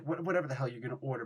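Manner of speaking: ordinary talk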